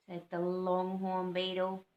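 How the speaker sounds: ordinary delivery, nothing marked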